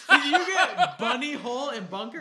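loud, high question through real laughter; helpless laughter breaking into the words; laughing. Real, not acted. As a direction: crack up while asking. Cracking up at the slip.

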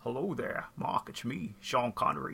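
low, soft film-star impression; Sean Connery. Podcaster as the voice in his head, low celebrity impression. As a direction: play the star as an inner voice, quietly. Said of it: "like Sean Connery's Your Daily Thoughts".

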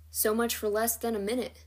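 plain reading voice

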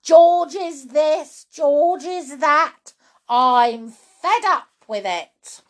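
loud, slow, heavily punched complaint; shouting grandma. Acted. Grandma rants about George, exasperated shout. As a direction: spell out each grievance crossly. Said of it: "shouted Grandma".

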